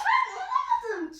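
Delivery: squeaky high-pitched voice; high thin voice. Real, not acted. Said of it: "Niye ince sesle konuşuyorsun?".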